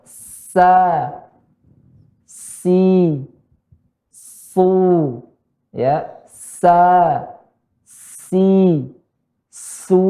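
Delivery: SA, SI, SU held long with tongue tip down; demonstrated light S. Shown as a demonstration. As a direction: stretch "Sa, si, su" on a thin hissed S. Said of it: "ujung lidah ke bawah".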